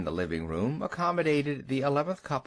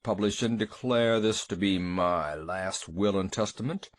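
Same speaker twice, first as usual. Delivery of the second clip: lower, solemn droning legal reading; deep portentous monotone; 5 semitones lower than usual. A narrator's reading. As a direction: read it like a bass note on a cathedral organ. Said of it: "read aloud in a deep, portentous monotone".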